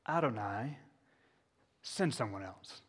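a mild hint of a whining Moses; reluctant Moses. Moses begging the Lord to send another, lightly voiced. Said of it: "here we go again".

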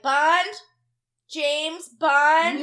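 loud delighted double take on the name; excited recognition. Retold or imitated. To the DMV customer, loud amused disbelief. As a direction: savor the name in loud glee. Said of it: "Because I was like".

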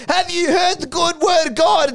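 high-pitched evangelising call; mock proclamation. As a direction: proclaim it like a doorstep zealot. Imitated preachy proclamation in row.

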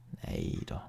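soft, low, barely voiced "Nej då"; said quietly. A narrator's reading. Dazed mother brushing off her child, very quiet and low. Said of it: "sa hon tyst".

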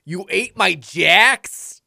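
put-on high, loud, drawn-out outrage in Milwaukee accent; incensed Milwaukee roommate, stretching every word; thick Milwaukee accent. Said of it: "thick Milwaukee's accent".

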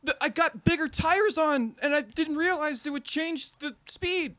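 high, pleading explanation; high wife voice. Acted.

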